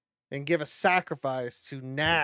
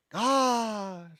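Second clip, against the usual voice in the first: ordinary talk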